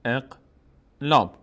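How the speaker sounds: اقلاب said very slowly in two separate parts, eq-lab; drawn-out term. Shown as a demonstration. Teacher names the rule for students, اقلاب slow and syllable by syllable.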